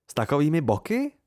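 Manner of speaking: mildly offended question with a rising finish; indignant. A narrator's reading. Devi mocking the doll's figure, indignant. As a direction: toss it back as an affronted question. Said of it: "pohoršila se".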